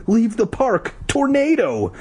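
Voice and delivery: high, urgent warning cry; mock shout. A narrator's reading. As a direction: warn a crowd in a high voice. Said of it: "she shouted".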